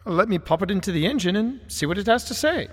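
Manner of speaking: courteous Victorian gentleman, gently concerned; Wellington's voice. Acted. Wellington proposes playing recording.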